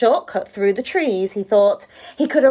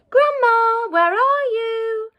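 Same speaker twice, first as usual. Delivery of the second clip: put-on much higher, louder slow child's call; 13 semitones higher, 4 dB louder, slower than usual. A narrator's reading. Girl calling for Grandma on arriving, high and loud. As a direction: call through the house as the girl.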